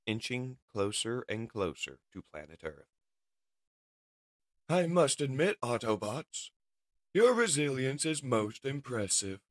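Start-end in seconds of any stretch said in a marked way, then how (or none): 4.7-9.4 louder, higher mock-admiring villain; grand villain praising his prisoners; villain captor. Shockwave addresses his captive Autobots, imperious.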